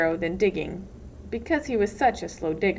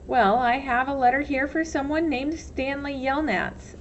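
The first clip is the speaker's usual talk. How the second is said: lightly voiced counselor; 5 semitones higher than usual. A narrator's reading. Pendanski voiced, mildly pointed.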